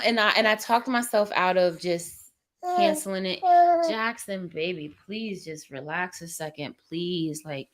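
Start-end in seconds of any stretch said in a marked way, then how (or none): 3.9-7.4 hushed, worn-out coaxing; weary pleading. Real, not acted. Pleading with her fussing baby.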